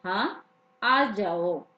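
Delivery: slow, easy teacher's yes; lightly voiced teacher letting a student in; teacher granting entry. Teacher calmly lets the student enter the class, slowly. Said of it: "वो कहते थे हमें".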